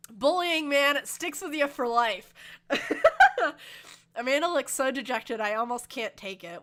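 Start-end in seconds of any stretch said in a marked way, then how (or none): 0.1-4.1 high, loud teary confession breaking into laughter; real tears, laughing through them; crying. Real, not acted. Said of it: "the crying chestnut".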